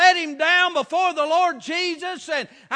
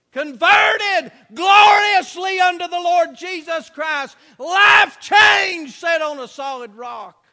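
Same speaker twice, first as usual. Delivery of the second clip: loud, shouted revival preaching; preaching at full force; 3 semitones higher, 9 dB louder than usual. Acted. Preacher shouting to his congregation about lost souls, fervent. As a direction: hammer it home to the pews.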